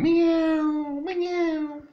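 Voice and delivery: very high, mewing cat voice; cat meow. Retold or imitated. Imitating Charlie the cat waking him, high meowing. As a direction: mew like the cat on your face. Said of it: "she wakes you up by crawling all over you and going".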